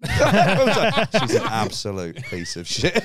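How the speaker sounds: slow, high, laughing shout; mum's laughing verdict. Retold or imitated. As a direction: blurt it out through laughter, slowly. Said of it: "her mum just going".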